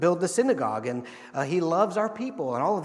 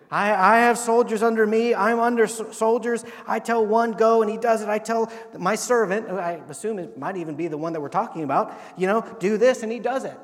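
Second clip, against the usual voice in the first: loud, high preacher's cadence; preaching at full force; 4 semitones higher, 7 dB louder than usual. Acted. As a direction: drive the point home to the congregation. Preaching the centurion's words.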